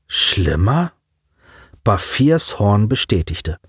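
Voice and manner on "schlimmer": drawn-out rising question; dip, then slide up in disbelief; disbelieving echo. A narrator's reading. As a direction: query the word warily. Stunned echo of alien's threat.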